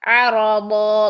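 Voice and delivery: آ، را دا said slowly with thick R and D; heavy dal. Shown as a demonstration. Teacher models thick letters slowly for the student. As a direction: fatten the da in را دا. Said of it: "دود موٹا کیجیے".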